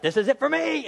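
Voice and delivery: high-pitched cry of doom; hammed-up despairing alarm; mock panic. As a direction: act out a jailer sure he's finished. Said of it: "he's in pure panic mode".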